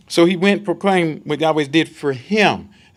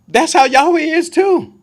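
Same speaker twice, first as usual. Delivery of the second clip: emphatic preaching; 10 semitones higher, 9 dB louder, slower than usual. Real, not acted. Emphatic sermon point.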